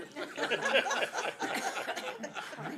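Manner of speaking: a long, high, breathy burst of laughter; high breathless laugh. Real, not acted. At the board meeting, remembering her mother, a high breathy laugh.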